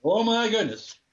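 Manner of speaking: high, hammed-up astonishment; big retold gasp of disbelief; mock amazement. Replays own stunned reaction, amused.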